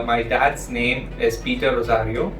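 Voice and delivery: ordinary talk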